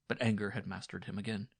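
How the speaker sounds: plain reading voice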